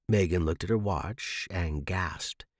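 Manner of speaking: ordinary delivery, nothing marked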